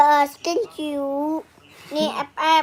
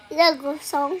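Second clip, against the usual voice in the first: ordinary talk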